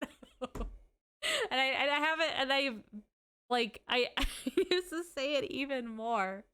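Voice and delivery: high, loud, helpless real laughter in the words; laughing while talking. Real, not acted. Streamer cracking up over own story, laughing. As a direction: struggle to get it out through laughter.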